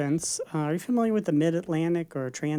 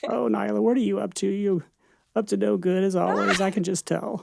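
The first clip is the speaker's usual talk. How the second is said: Bill Clinton; 4 semitones higher than usual. Shown as a demonstration. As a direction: tease her in a folksy Arkansas politician's accent. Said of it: "pretty good Bill Clinton accent".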